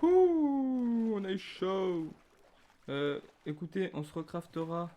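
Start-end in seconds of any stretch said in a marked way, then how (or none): 0.0-2.1 loud high whoop gliding down; whooping after a close shave; relieved whoop. Real, not acted. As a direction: let out a big relieved "ouh", then settle.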